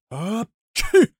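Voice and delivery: big high sneeze; loud sneeze. Acted. Karabas sneezing at the fire. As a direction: let the sneeze explode.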